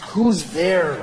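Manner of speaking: mock profound shouting; mock epic cry. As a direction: turn a knock-knock answer into an existential cry.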